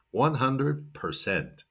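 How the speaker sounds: "percent" slowly as "per-SENT"; slow model phrase, clear "per" in "percent"; correct percent. Shown as a demonstration. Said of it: "Be sure to say percent".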